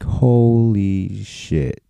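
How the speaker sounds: very slow, drawn-out amazement; awestruck. Retold or imitated. Friends watching him hold the smoke in, awestruck. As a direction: stretch it out in mock awe.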